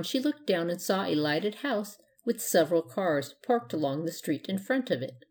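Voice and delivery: ordinary delivery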